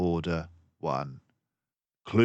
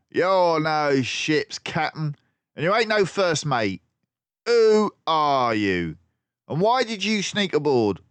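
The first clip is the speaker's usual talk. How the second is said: gruff villain; 10 semitones higher, 6 dB louder than usual. Acted. Crook interrogating the disguised detective, loudly. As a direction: demand answers like a thug. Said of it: "said one of them".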